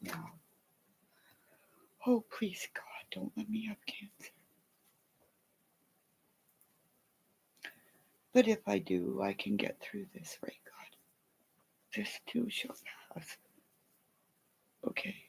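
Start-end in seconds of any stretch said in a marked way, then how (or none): 2.1-13.4 hushed pleading whisper to God; whispered prayer. Real, not acted. Whispering a prayer to God about cancer. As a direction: pray under her breath.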